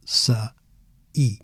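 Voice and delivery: "saí" as sa, pause, ee; two syllables. Shown as a demonstration. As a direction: pull the vowels of "saí" apart. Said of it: "two syllables".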